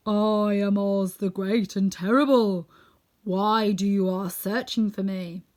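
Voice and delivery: slow, loud, drawn-out proclamation; booming solemn wizard, words stretched out. A narrator's reading. Unseen Oz addresses the visitors from the dome, solemn and loud. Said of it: "they heard a solemn voice".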